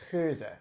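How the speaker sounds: Geuze with a vowel between oo and uh; throaty G in Geuze, rounded hybrid vowel, final uh; Dutch pronunciation. Shown as a demonstration. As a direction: say Geuze the Flemish way, guttural at the start. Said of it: "kind of a hybrid".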